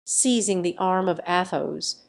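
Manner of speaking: ordinary delivery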